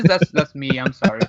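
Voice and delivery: ordinary talk, nothing marked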